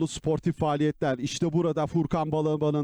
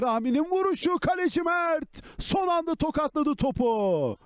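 excited commentary; 8 semitones higher than usual. Real, not acted. Big save by the keeper.

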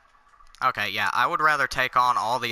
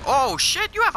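startled shout; 14 semitones higher, 5 dB louder than usual. Real, not acted. Another enemy appears.

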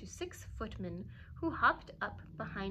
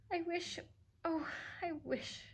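a mild tearful catch in the voice; choked with tears; 7 semitones higher than usual. A narrator's reading. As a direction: Cinderella to her godmother, lightly tearful. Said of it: "so choked with tears".

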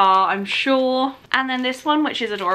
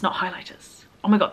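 normal talking voice

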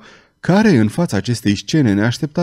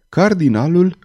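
usual speaking voice

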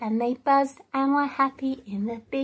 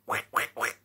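duck quacking; 6 dB quieter than usual. Shown as a demonstration. Duck imitation.

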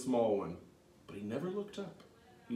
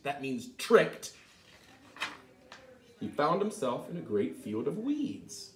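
lively read-aloud narration; storybook narration; 2 semitones higher, 8 dB louder than usual. A narrator's reading. Reading Tom's tale to children, lively storytelling. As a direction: tell it like a bedtime story.

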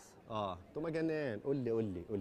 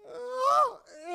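long high comic wail, drawn out slowly; slow high mock lament; hammed-up wail; 10 semitones higher, 3 dB louder, much slower than usual. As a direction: stretch out a mock-tragic cry. Comic wailing before my son.

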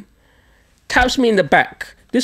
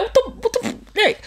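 much higher, cut-off startled cry; startled squeal; 4 dB quieter than usual. Retold or imitated. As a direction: yelp it as you spin around. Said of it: "I turn around like".

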